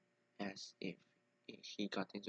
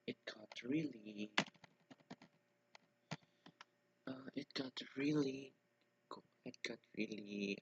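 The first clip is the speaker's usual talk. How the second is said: halting restarts, stuck on words; stuttering; 4 semitones higher than usual. Real, not acted. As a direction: stumble and restart the phrase. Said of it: "that's why I'm stuttering".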